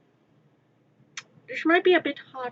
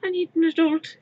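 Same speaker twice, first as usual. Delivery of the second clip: put-on higher, louder childish plea; quoted cartoon panic; 7 semitones higher, 5 dB louder, faster than usual. Quoting DBZ Abridged, a high panicked childish plea. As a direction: squeak it like a scared kid. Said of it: "quoting D B Z abridged".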